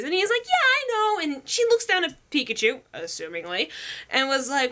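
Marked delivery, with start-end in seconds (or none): 0.5-1.2 very high, flustered reply; Ash. Acted. Flustered boy answering the girl, panicky and high.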